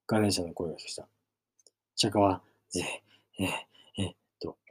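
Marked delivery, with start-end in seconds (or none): 2.7-4.2 ragged panting breaths, a touch quieter; worn-out wheezing gasps; gasping breaths. Acted. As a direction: gasp for air after the ride. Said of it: "肩で息をし".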